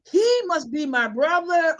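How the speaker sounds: ordinary talk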